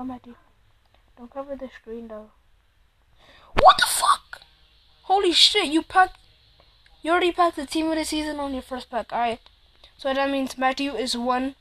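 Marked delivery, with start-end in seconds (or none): 3.6-4.4 high, loud shocked shriek; jolted out of him in disbelief; shocked yell. Real, not acted. Reacting to a lucky pack. 5.0-8.4 excited. Real, not acted. Reacting to a lucky pack.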